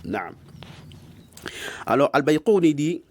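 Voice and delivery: ordinary talk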